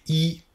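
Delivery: "I" held high and level; Mandarin first tone. Shown as a demonstration. Teacher models "I" for students: high level tone. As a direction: sing "I" high and steady, first tone. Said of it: "le premier ton doit être fait bien haut et bien stable".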